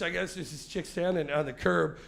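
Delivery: normal talking voice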